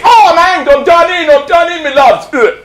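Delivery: high, strangled puppet cries; hammed-up dying puppet, ending in a choke; hanged beadle. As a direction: wail it high as if on the noose. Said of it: "the beadle's hanging like this".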